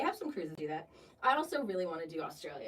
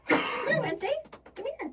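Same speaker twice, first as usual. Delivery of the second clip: high sing-out call to a pet; 6 dB louder, faster than usual. Real, not acted. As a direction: coax the dog over in a much higher voice. Calling the pet over to them.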